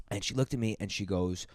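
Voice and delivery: normal talking voice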